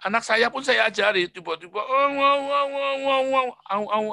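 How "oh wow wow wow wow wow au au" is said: mock toddler babble. Mimicking a child's babble.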